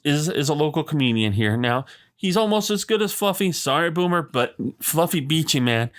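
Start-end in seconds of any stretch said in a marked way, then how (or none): none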